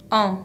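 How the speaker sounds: en said slowly through the nose, no n; nasal en. Shown as a demonstration. Teacher demonstrating the nasal en to the class. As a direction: hold the nasal en. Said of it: "se pronuncia".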